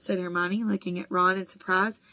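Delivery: ordinary delivery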